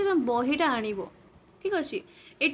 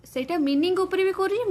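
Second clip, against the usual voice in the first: ordinary talk, nothing marked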